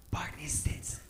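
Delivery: awed, voiceless whisper; starstruck whisper. Acted. Fan spotting a TV star at the gym, starstruck whisper. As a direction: whisper the name of a star you've just spotted. Spots his TV idol beside him.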